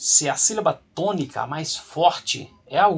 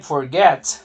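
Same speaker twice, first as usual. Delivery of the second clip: "forget" with GET punched louder; stress on last syllable of forget; 7 dB louder than usual. Shown as a demonstration. Teacher introduces second example to students, "forget" stressed on GET. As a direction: hit the final syllable of "forget" hard. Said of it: "a sílaba tônica é o final".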